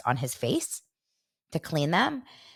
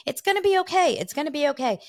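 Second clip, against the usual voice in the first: mock soothing; 11 semitones higher, 3 dB louder than usual. Host retelling how the co-host soothed his crying partner, high and soothing. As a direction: comfort her like a worried father.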